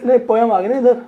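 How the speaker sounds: normal talking voice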